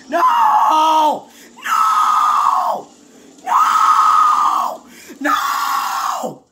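long, loud screamed noes; anguished screaming. Real, not acted. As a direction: scream in defeat until the voice gives out. Loses at the last moment.

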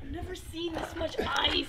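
whispering. Real, not acted. Awed by the ice.

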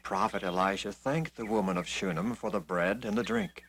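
ordinary delivery, nothing marked

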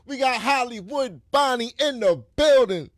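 loud, high, drawn-out MC introduction; hype announcer intro. Real, not acted. Introducing a podcast co-host, hype announcer style. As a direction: announce the host to a roaring crowd.

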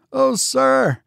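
very high, pleading interruption; old servant's cry. A narrator's reading. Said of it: "cried Biggs".

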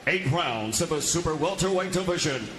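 normal talking voice

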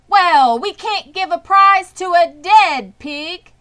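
booming public-address voice; loudspeaker announcer. A narrator's reading. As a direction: announce it firmly to the crowd. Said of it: "said the loudspeaker".

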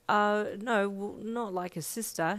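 slow halting stammer. Acted. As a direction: stumble over the words. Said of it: "he stammered".

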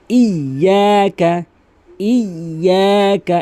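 ইয়াকা slowly with a doubled ক; slow careful ইয়াকা; correct recitation. Shown as a demonstration. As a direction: hold the ক of ইয়াকা before releasing. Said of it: "এভাবে ইয়াকা নাবুদু".

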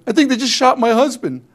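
high, loud alarmed report; alarmed wife. Retold or imitated. Wife telling friends husband was shot, high and alarmed.

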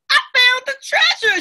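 high, loud triumphant cry; joyful shout. A narrator's reading. Amma finding the buried treasure, shouting with joy. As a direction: shout it out in delight. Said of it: "she shouted with joy".

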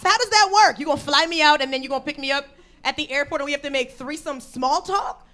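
usual speaking voice

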